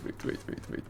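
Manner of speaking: whispered string of waits; under-the-breath pleading to stop. Real, not acted. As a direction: breathe wait over and over. Stopping the video hastily.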